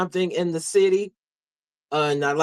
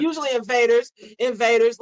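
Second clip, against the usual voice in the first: sarcastic; 6 semitones higher, 3 dB louder than usual. Real, not acted. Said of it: "I say this sarcastically".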